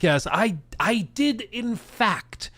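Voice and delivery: put-on a fairly light posh British accent. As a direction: play the smug English mastermind in a posh British accent. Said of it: "He's like".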